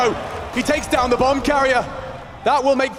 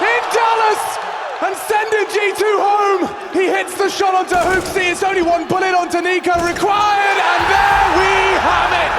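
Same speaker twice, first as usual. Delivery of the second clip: esports caster; 7 semitones higher than usual. Real, not acted. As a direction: yell the winning moment to the crowd. Caster calls the match-winning shot.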